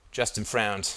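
usual speaking voice

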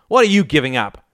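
put-on resentful teenage retort; snarl. A narrator's reading. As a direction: throw the challenge back angrily. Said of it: "snarled Ian".

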